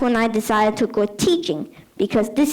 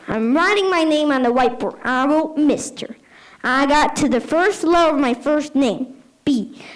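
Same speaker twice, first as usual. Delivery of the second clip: ordinary talk, nothing marked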